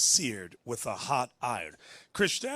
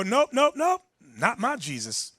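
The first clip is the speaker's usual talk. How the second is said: mock stubborn refusal; 7 semitones higher, 4 dB louder than usual. Voicing stubborn Christians refusing.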